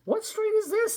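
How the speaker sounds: high, puzzled man; lost man voice. Acted. A man asking Sammy for directions. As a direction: ask it like a lost stranger. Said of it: "said a man".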